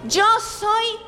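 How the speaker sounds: slow, emphatic declaration; drawn-out, forceful call-and-repeat line. Shown as a demonstration. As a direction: proclaim it word by word for the congregation. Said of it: "repita conmigo".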